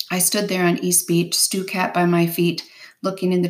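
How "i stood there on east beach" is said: ordinary delivery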